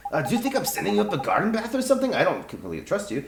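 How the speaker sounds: a fairy playing up being tiny and put-upon; cheeky fairy voice. Fairy confessing to the boy he has no wand, cheeky.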